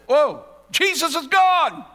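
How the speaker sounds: high, loud, slow alarmed gasp of a line; sudden panic, Jesus is gone; alarmed realization. Acted. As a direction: parents discovering their son is missing, alarmed. Parents realize Jesus is missing.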